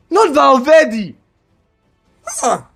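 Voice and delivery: normal talking voice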